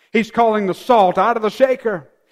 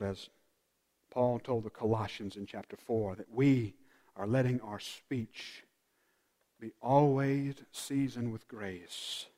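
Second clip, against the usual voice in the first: soft, hushed sermon voice; intimate, softly spoken reflection; hushed preaching; 9 semitones lower, 14 dB quieter than usual. Acted. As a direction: draw them in with a near-murmur.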